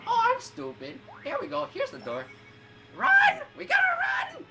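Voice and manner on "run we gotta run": high, loud panicked yell; frantic high-pitched alarm; urgent shout. Real, not acted. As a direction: yell to flee right now. Urgent escape in game.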